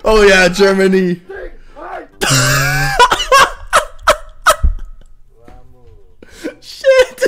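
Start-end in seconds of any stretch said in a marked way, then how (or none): 1.2-5.2 squealing laughter; long giggle fit; high-pitched laugh. Real, not acted. Reacting to funny clip.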